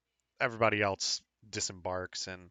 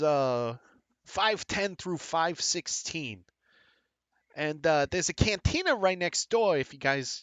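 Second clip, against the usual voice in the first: chatty Boston clerk, pitched up; Bostonian accent; 5 semitones higher than usual. Acted. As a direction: give friendly directions in a Boston accent. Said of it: "a Bostonian accent".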